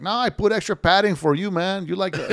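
mock foreign upholsterer insisting on padding; Zirkistan upholsterer accent. Upholsterer pushing extra padding, mock Zirkistan accent. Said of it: "that was my, uh, accent of, uh, the guy from Zirkistan".

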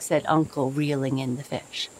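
ordinary delivery

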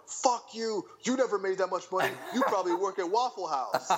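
high-pitched, jeering heckler imitation; mock troll outrage. Imitating people who attack his free course post, mock outrage. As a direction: hurl it like an indignant troll, hammed-up. Mimicking hostile online trolls.

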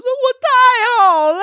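high, slow, drawn-out mock wail; imitating crying friend. Friend sobbing in the museum, slow mock wail. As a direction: stretch it out like a sobbing art lover. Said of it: "还大哭说".

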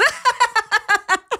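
high burst of laughter; imitated sleep laughter. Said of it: "He just starts laughing".

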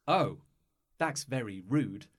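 ordinary talk

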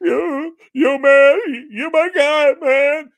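high, loud mocking blubber of a crying coach; mock tearful coach. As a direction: ham up the sobbing coach.

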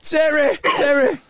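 mock mum calling Terry; imitating [name]'s mum calling; copying the mum. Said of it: "I'll do me mum".

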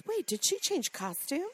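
high, lightly voiced confused question; puzzled question. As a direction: ask it as if baffled. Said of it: "Josephine earlier said".

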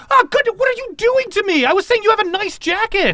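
shrill, fast, high yelp; high pained shout. Acted. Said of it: "they shout".

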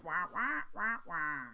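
muffled droning wah-wah of the Peanuts teacher. Retold or imitated. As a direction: drone like the cartoon teacher's garbled voice. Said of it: "the teacher in Peanuts that was like".

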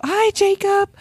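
high called-out greeting; kids shouting hello. Retold or imitated. Mother imitating her kid calling from the audience. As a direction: call it out like a toddler in the audience. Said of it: "they can't shout".

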